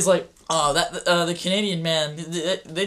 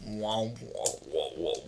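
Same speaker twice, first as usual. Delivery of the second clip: low, soft wah wah sinking lower; robot boss wah wah; 8 semitones lower, 11 dB quieter than usual. Retold or imitated. Mocking the Cuphead robot boss with sinking wah wahs. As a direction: let the wah sound drop deep and quiet. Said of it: "That's exactly what he sounded like".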